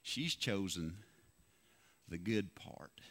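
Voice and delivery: "She's chosen the good part" dropped low and soft as God's gentle answer; God's quiet voice. Retold or imitated. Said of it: "He said".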